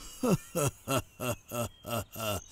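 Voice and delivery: soft scornful villain laugh; quiet sneering chuckle; mocking laugh. Villain mocks talk of dying. (rire moqueur et méprisant)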